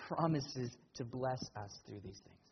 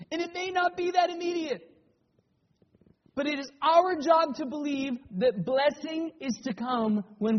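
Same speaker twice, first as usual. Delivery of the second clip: loud, higher, insistent sermon voice; full-force preaching; 10 semitones higher, 10 dB louder than usual. Acted. Pastor telling the congregation to believe in blessing, full-force preaching. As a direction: drive the point home to the pews.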